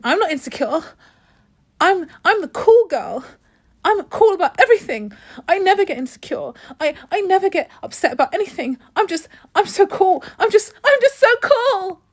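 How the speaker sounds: high, loud mock cool girl; cool girl parody. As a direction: ham up the insecure cool girl who insists she's fine. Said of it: "trying not to look in".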